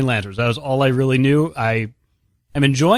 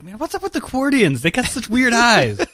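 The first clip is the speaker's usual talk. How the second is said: puzzled mock complaint; 8 semitones higher than usual. Imitating puzzled comic reader.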